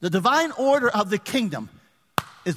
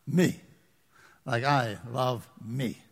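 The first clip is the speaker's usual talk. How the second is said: self-lover voice; 8 semitones lower, 5 dB quieter, slower than usual. Pretend.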